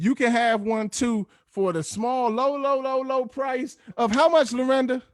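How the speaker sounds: high, drawn-out advert announcer; sales pitch. Pretend. Host hawks caps to callers, mock sales pitch. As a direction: sell it slowly with a pitchman's lift.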